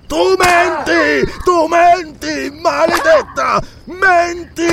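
high, drawn-out raving accusations; crazed yelling. A narrator's reading. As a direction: yell like a madman while whipping. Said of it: "gridando con occhi da folle".

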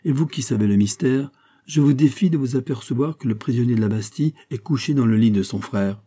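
plain reading voice

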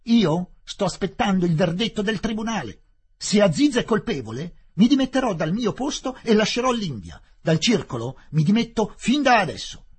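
loud, high, resolute announcement; Fielding declaring he will leave the club; defiant declaration. Acted. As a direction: throw down the resignation publicly.

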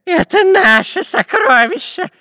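very high, slow, stretched-out shriek; inhuman scream. A narrator's reading. Spy claiming the treasure for himself and captain, screaming. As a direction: shriek possessively, dragging out the words. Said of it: "нечеловеческим голосом закричал шпион Дырка".

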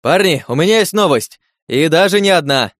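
loud, high announcement shout. Acted. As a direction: shout the news to the crowd. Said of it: "прокричал Трай".

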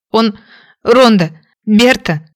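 usual speaking voice

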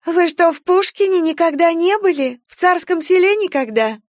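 high-pitched, loud tipsy amazement; boisterous surprise. A narrator's reading. Tipsy relatives astonished at the guests over supper, high boisterous surprise. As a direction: exclaim it over a noisy table. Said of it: "расшумелись".

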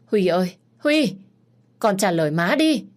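high, loud frantic calling through the door; panicked calling. A narrator's reading. Mother calling her son through the locked door in panic. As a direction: call out to the silent child in panic. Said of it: "bà hoảng sợ, vội vàng gọi cửa".

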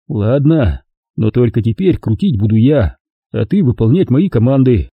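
usual speaking voice

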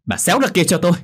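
acted furious daughter; angry yell. A narrator's reading. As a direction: yell at mother to back off. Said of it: "rồi gào lên".

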